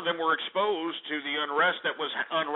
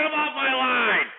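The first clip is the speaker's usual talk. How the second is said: angry shout; 8 semitones higher, 3 dB louder than usual. Real, not acted. Host yells to drop caller.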